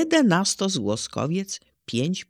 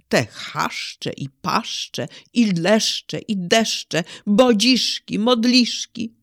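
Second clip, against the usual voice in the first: poem recitation; 4 semitones higher, slower than usual. Shown as a demonstration. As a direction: recite with growing wonder, beat by beat.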